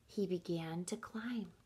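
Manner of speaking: hushed whisper. A narrator's reading. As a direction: whisper to build suspense. Whispered suspense.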